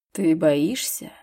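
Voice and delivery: low, slow, surprised rising ты; surprised question. A narrator's reading. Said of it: "Его бровь удивлённо выгнулась". (удивлённо, низко и медленно, с подъёмом)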